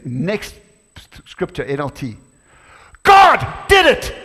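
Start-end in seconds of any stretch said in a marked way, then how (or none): 3.0-4.1 shouted high and slow with joy; exclaimed. A narrator's reading. Preacher reading David's joyful cry, exclaimed. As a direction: cry it out in triumph. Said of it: "David exclaimed".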